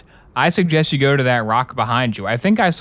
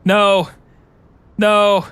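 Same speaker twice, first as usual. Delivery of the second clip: rising pretend screams, louder and higher; terrified scream; 8 semitones higher, 5 dB louder, much slower than usual. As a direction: scream as the zombies break in. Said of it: "you're really scaring me".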